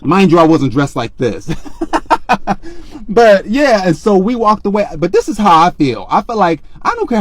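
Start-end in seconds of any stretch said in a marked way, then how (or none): 1.5-3.0 high burst of laughter. Real, not acted. Laughing at own story.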